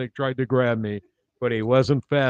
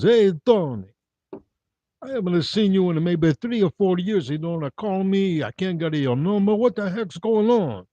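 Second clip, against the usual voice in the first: put-on old Italian guy; 6 semitones higher, 3 dB louder than usual. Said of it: "First one says".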